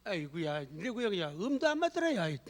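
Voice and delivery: quiet grumbling complaint about bad singing; mock grumbling. Churchgoer grumbling about his group's off-key hymn, soft and sour. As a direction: mutter disapproval under your breath. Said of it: "구역 찬송하고 그러면".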